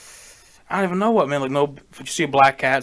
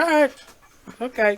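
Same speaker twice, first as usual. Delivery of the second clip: meek agreement; 10 semitones higher than usual. Retold or imitated. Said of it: "I was like".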